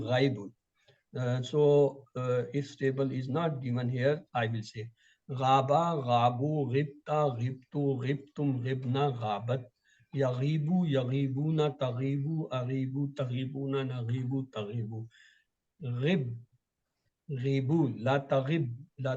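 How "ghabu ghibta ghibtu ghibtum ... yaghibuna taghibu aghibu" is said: ordinary talk, nothing marked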